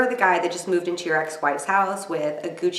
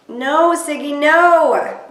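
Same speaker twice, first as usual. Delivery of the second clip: pleading shout; 7 semitones higher, 6 dB louder, much slower than usual. Real, not acted. Pleading at screen as Siggy speaks.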